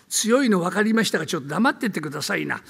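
ordinary talk, nothing marked

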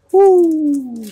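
high hooting owl call. A narrator's reading. Owl answering the chickens with a high hoot. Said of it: "And the owl said".

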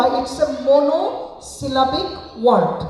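It's a monosyllabic word, drawn out slowly syllable by syllable; teacher spells each beat out slowly for the kids; syllable-by-syllable demo. Shown as a demonstration. Teacher modelling the answer for the class, slow and syllable by syllable. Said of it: "তো তুমি বলবা".

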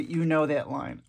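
ordinary delivery

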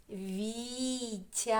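Вітя slowly stretched into VI - TIA; syllable by syllable. Shown as a demonstration. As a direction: draw out both syllables of Вітя. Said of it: "Теж два склади". (Вітя протяжно, по складах)

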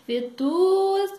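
loud, high drawn-out order; calling to vendor. Acted. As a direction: sing it out to the apple seller. Granny calls to apple seller.

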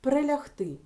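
Прилягти called out high and loud; teacher announcing the next word; announced example word. Shown as a demonstration. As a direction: present the word to the class loudly. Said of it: "Чи можемо ми оцю частинку".

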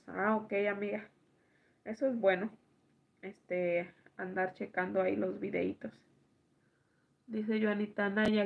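usual speaking voice

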